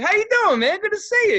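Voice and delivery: high, loud hello; hammed-up hearty greeting. Giving his coworker a high five, loud and hearty. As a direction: greet them across a whole bar.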